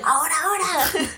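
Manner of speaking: high, laughing call of now now; retold hurried signal. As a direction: mimic a friend signalling it's time. (llamada aguda entre risas)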